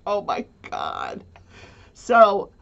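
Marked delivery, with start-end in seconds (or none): 0.1-1.2 much lower, slow stunned moan; lightly voiced dismay, low and slow; stunned groan. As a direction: drop low and drag it out in disbelief. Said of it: "I'm like".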